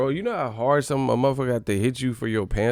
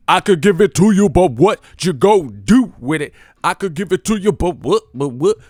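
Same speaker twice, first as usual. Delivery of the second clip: loud, high, punched rap delivery; 5 semitones higher, 9 dB louder than usual. Acted. Showing how to say it, loud rapper impression. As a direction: belt it out in bursts, pitched up. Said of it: "say it like J O Felony".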